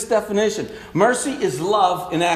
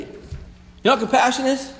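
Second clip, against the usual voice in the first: ordinary talk, nothing marked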